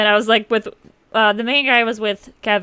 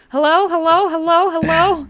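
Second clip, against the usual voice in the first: higher repeated hellos; imitated phone hellos; 5 semitones higher, 3 dB louder than usual. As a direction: call hopefully down a dead phone line. Retold phone answerer calling out repeatedly.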